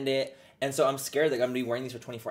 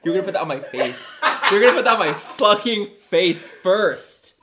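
mock outrage; 6 semitones higher, 9 dB louder than usual. Real, not acted.

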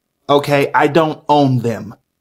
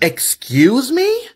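high, slow indignant question; offended; 8 semitones higher, slower than usual. Acted. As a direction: take offense. Offended parent.

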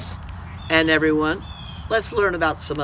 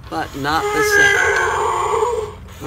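same drawn out high and very slow; sing-song stress; much slower than usual. Shown as a demonstration. To the kids, stressing the point: same stretched high and slow.

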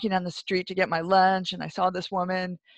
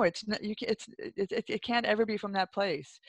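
ordinary talk

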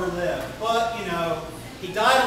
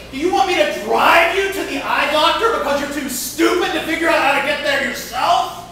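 much higher, louder furious ranting; losing it; 9 semitones higher, 9 dB louder than usual. Retold or imitated. Rookie pitcher screaming insults at the umpire, furious. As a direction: rant at the umpire like you've lost it. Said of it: "the kid is really losing it".

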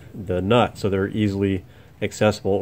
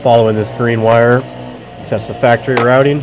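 yelling over leaf blower. Real, not acted. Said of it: "Sorry about the leaf blower, guys".

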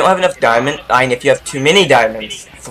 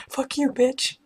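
whispered through laughter, high; breathless laughing; 13 semitones higher, 8 dB quieter than usual. Real, not acted. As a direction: wheeze it out while laughing. Laughing repeat of a line.

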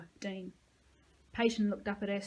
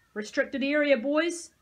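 stern soldier; 5 semitones higher, 9 dB louder, slower than usual. A narrator's reading. Soldier barring the boys.

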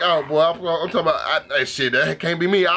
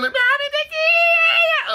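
very high drawn-out mock squeal; exaggerated stretched-out squealed plea; 3 dB louder, much slower than usual. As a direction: squeal it like a whining child, imitating. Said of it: "That man just started squealing".

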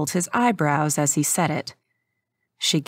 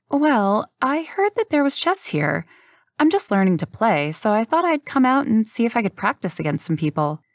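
higher, sweet innocent voice; faux-naive girl; 5 semitones higher than usual. Rook fooling the chess players, higher innocent voice. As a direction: play the clueless beginner.

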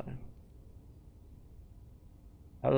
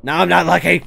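loud, exasperated outburst; frustrated shout; 7 semitones higher, 13 dB louder than usual. Real, not acted. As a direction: yell it in frustration at the fall. Falls back down, frustrated.

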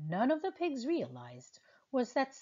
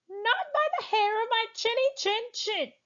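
high, defiant pig voice; squeaky little pig refusing; 12 semitones higher, 4 dB louder than usual. Acted.

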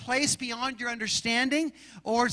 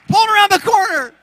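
Pulled yelled higher still, strained; even higher shout; 9 semitones higher, 12 dB louder than usual. Real, not acted.

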